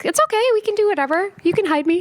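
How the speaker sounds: high, fast, eager little voice; mock-sweet high reassurance; eager quote. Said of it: "'cause I was like".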